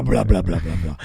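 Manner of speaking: deep, heavy comic blubbering; big floppy noise. Retold or imitated. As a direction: drop low for a big flopping sound effect. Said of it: "L-, big penis".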